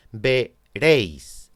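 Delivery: "veréis" very slowly, "ve" then "réis"; "veréis" divided as "ve-RÉIS"; split into syllables. Shown as a demonstration. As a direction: say "veréis" in two beats, keeping "éi" together. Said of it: "la palabra tiene dos sílabas".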